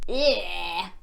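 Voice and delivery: plain reading voice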